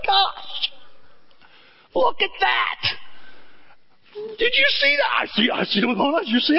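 high, breathless excited chatter; amazed disciples. Retold or imitated. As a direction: squeal with wonder at the miracle.

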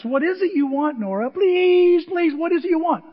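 high, loud mock pleading; hammed-up exasperated imitation; retold exasperation. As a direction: stretch the please out loud and high, exaggerating. Retold exasperated grandpa exchange.

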